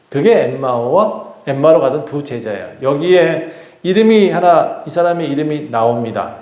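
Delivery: ordinary talk, nothing marked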